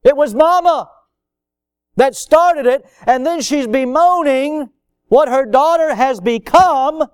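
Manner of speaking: loud, high, impassioned pulpit delivery; full-force preaching. Real, not acted. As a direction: hammer the blame home from the pulpit.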